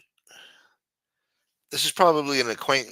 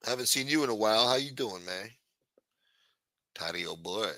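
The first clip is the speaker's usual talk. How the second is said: ordinary talk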